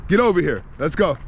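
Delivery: calling out. Real, not acted. Speaker interrupts himself to get Blue over here, calling out.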